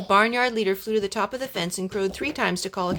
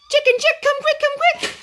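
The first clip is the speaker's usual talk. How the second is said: very high, loud rooster alarm call; alarm crow; 9 dB louder than usual. A narrator's reading. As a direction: crow the alarm to wake the coop. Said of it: "crowed the call of alarm".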